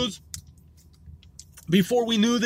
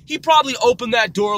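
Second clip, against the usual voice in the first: amused exclamation; 5 semitones higher, 7 dB louder than usual. Retold or imitated.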